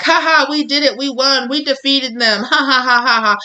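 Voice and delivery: high-pitched gloating celebration; mock victory cheer. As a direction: crow over the win like a toasting crowd. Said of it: "and they're cheer-, they're like".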